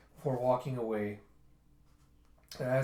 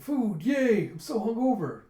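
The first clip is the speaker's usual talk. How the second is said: high, loud hungry delight; excited cheer; 9 semitones higher, 8 dB louder, slower than usual. Acted. Diners greeting bowls of breakfast, excited cheer. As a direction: cheer for the food. Delight at being served food.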